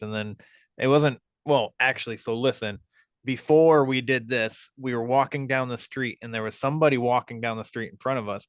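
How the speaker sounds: normal talking voice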